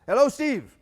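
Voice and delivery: put-on loud, high-pitched German-accented greeting; imitated Icelander with a German-style accent. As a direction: hail him in a mock German accent. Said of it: "a German accent".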